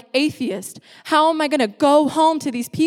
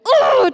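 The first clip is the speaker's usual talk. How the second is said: high, loud frustrated groan; acted-out cry of rage, pitched way up; retold outburst; 13 semitones higher, 6 dB louder than usual. Showing her state before the verse changed her, mock raging groan. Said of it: "I went from, like".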